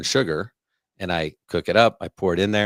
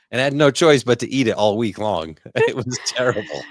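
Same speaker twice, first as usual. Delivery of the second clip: laughing; 5 semitones higher, 5 dB louder than usual. Real, not acted. Laughing over cooking disaster.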